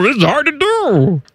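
high, effortful put-on voice; strained voice. Host struggling to keep up the impression, high and strained. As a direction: squeeze it out as if nearly choking. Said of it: "gonna choke on yourself".

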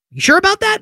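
high sceptical jab; doubting challenge; sarcastic disbelief. Real, not acted.

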